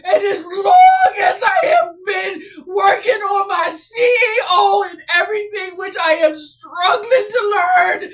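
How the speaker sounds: pushing words out through happy tears; crying with joy. Real, not acted. Creator telling viewers she ranked first, crying happily. Overjoyed, store finally on Google.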